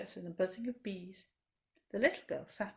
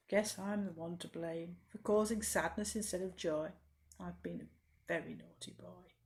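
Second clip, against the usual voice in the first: low, soft shamefaced admission; ashamed; 4 semitones lower, 6 dB quieter than usual. Acted. Mean butterfly admitting blame to Dot, low and ashamed. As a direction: hang your head and murmur it.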